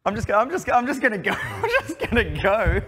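high, loud, laughing embarrassment; bow out helplessly through laughter; embarrassed laughing. Real, not acted. Caster bowing out after botched accents, embarrassed laughing.

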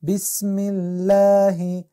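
بسم الله with الله said thin, light lam; slow recited بسم الله, thin الله; thin Allah. Shown as a demonstration.

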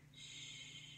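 soft breathy hush; whispered shh. A narrator's reading. The wind whispers to the bunny, soft shh. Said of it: "The wind whispered".

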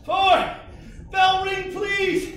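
ordinary delivery

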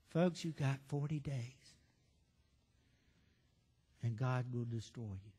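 low, soft, matter-of-fact doom notice; flat, quiet warning. Retold or imitated. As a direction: drop it low and quiet, no preaching fire. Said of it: "This is the way he said it".

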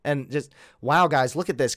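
normal talking voice

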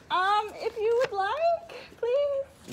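high, slow mock pleading. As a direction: coax sweetly, begging. Coaxing friend into choosing.

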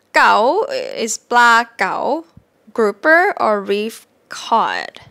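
very slow, deliberate explanation; slow clear teaching. Shown as a demonstration. Teacher slowly explaining the fish name. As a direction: spell it out slowly. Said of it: "the rising tone version".

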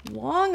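long heavy sigh; dramatic sigh before the line; big sigh. A narrator's reading. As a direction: heave a great big sigh. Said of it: "the hen heaved a great big sigh".

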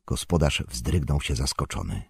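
usual speaking voice

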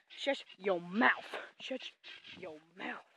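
slow, word-by-word telling off; emphatic, drawn-out reprimand; slow scolding. Real, not acted. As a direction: space out each word as an order. Scolding a noisy animal, slowly repeated.

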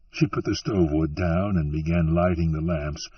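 ordinary delivery

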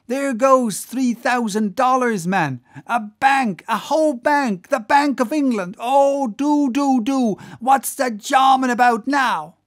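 loud, high, breathless-paced pleading with the rowers; frantic excited cries. Acted. Whaler to his lads as a fortune swims off, frantic excited cries. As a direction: beg them to pull, hopping with excitement. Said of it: "cried Flask, dancing up and down".

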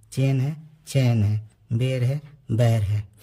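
"चेन" with e then "चैन" with ai, "बेर" then "बैर"; vowel pairs. Shown as a demonstration. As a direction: say each pair so the vowel change is clear. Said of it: "ये ऐ की मात्रा है". ("ए" और "ऐ" की जोड़ियाँ साफ़ बोलना)

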